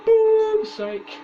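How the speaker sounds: loud annoyed exclamation; frustrated outburst. Real, not acted. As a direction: blurt out in frustration.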